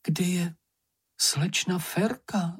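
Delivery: high, searching call. A narrator's reading. Mumínek looks for missing slečna Ferka, high call. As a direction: call into the dark for someone. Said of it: "zvolal Mumínek".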